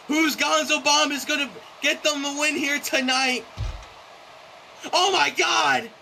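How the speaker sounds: excited shout. Real, not acted. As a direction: erupt as the big move hits. Big move lands.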